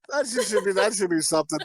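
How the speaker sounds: ordinary talk, nothing marked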